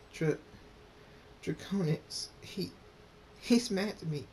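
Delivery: high, halting, tearful character voice; high-pitched and hesitant; upset little Rain. A narrator's reading. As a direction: stammer it out as an upset child character. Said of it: "Rain bursts in crying".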